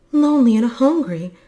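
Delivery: high, loud lament; pitying wail, voiced by a narrator; distressed cry. A narrator's reading. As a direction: cry out in dismay for him. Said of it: "and she cried".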